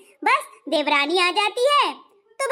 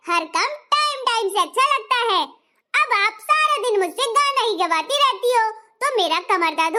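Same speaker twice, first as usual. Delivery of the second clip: sulky elder bahu; 2 semitones higher than usual. Acted. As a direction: complain like a pampered bahu. Plays the lazy elder bahu.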